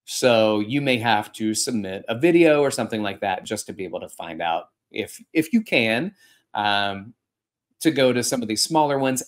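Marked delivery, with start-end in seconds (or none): none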